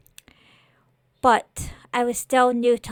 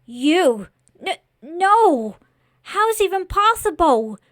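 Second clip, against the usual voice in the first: high, loud cry of disbelief; horrified disbelief; 7 semitones higher, 7 dB louder than usual. Acted. Boy faces the living scarecrow by the corpse, horrified disbelief.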